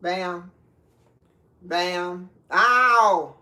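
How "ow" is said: loud, higher-pitched "ow!" whoop; celebratory whoop. Real, not acted. Host cheering as the dish comes along, whooping.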